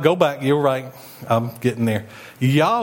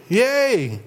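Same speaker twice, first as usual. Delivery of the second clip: mock cheer; 8 semitones higher than usual. Imagining slaves cheering freedom.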